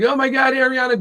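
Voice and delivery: high, excited fangirl squeal; squealing fan. Retold or imitated. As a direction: gush over her like a starstruck fan.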